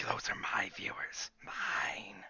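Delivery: hoarding whisper, jealous; possessive whisper. Retold or imitated. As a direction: whisper it like a miser. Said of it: "communities where it's like".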